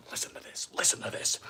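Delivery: hushed whisper so the crunch is heard. Real, not acted. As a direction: breathe the words, no voice. Asks viewers to hear crunch.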